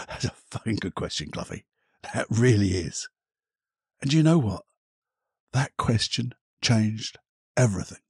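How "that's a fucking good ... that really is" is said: a low, soft hint of an inner voice; lightly voiced praise from within; approving inner voice. Inner voice praising him for the question, low and soft. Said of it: "that voice inside to me said".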